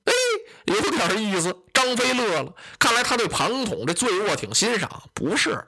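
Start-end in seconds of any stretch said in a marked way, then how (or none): none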